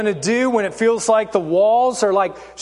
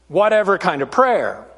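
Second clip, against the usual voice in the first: normal talking voice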